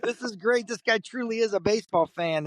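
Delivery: high, thrilled fan exclamation; acted-out fan excitement; retold delight. Retelling his delight at the Pope.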